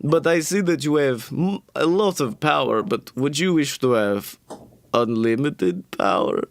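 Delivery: put-on goblin tempter with a Dracula-like Eastern European accent. Goblin offering a traveler power, Eastern European accent. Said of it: "He's like".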